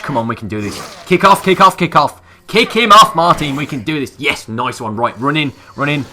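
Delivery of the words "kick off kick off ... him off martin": loud, high yells of kick off; desperate shouting. Real, not acted. Gamer screaming at his character to break free, desperate shout. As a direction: yell it like your life depends on it. Desperate fight against feral.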